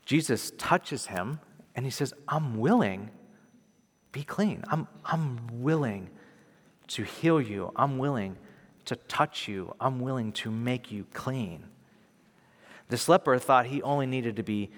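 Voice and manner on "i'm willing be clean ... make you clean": soft, slow, tender reassurance; hushed compassion, voicing a healer's promise; moved with compassion. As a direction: speak gently, leaving space between phrases. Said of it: "so moved with compassion".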